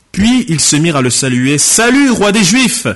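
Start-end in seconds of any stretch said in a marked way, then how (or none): 1.6-2.9 raised, high-pitched jeering hail; mocking salute. Soldiers saluting a condemned prisoner as king, mocking. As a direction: hail him in loud mock homage, voice pitched up. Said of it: "après s'être moqués de lui".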